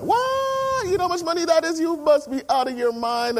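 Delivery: father shocked at his daughter's idea; shocked outburst. Retold or imitated. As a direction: squeal of disbelief at the cost. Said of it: "And I went".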